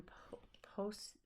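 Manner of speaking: unvoiced whispered stumble; whispering. Real, not acted. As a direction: whisper the syllables trying. Whispered attempt at name.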